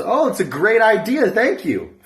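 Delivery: high, loud eager thanks; delighted husband. Acted. Husband thanking the Australian helpline, delighted. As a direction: jump on the idea gratefully.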